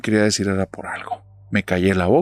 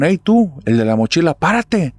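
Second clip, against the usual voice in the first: put-on high, louder yell at a passing kid; retold gang shout; 7 semitones higher, 4 dB louder than usual. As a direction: call him out to stop him. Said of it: "Y nada más me gritaron". (grito amenazante, más agudo y fuerte)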